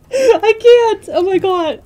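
high and loud, barely containing it; overjoyed shriek. Real, not acted. Streamer overwhelmed by luck, real joyful shriek. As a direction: scream it out in total disbelief.

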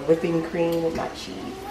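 ordinary delivery

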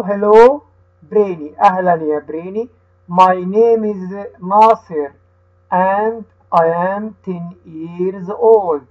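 slow, careful reading with each word set apart for the class to repeat; teacher reads the dialogue slowly so the children can repeat; slow read-aloud for repeating. Shown as a demonstration. Teacher reading slowly to children who repeat after her. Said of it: "هقرأها أنا وهتقول ورايا".

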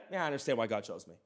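imitating a self-satisfied Paul; mock smug. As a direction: toss it off like a conceited brag. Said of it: "Paul could be like".

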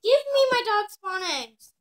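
high, loud indignant demand; whiny demand. Real, not acted. Little sister demanding her dog spawn eggs back, whiny.

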